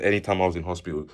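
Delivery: usual speaking voice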